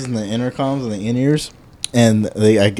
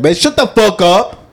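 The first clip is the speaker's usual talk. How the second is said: higher put-on voice snapping back; mock retort; 8 semitones higher than usual. Friend retelling Mason's imagined retort to the customer, higher put-on snap. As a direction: play the cocky friend's comeback. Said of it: "He's like".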